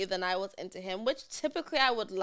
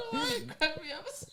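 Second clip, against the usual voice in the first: laughing. Real, not acted. Laughing fit after sneeze.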